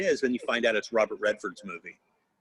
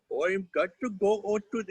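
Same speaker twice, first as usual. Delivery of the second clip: exaggerated Indian accent, a notch down from cartoon-high; same put-on Indian accent in a somewhat deeper character voice; lower-voiced Indian dialect; 10 semitones higher than usual. Child voice actor obeying a director's request, Indian dialect in lower voice. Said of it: "same dialect but in a lower voice".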